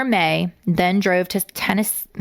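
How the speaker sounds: ordinary talk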